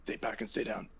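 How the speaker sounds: hushed, voiceless warning to comrades; whisper. Acted. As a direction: whisper it urgently so only the party hears. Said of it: "I whisper to Grognak".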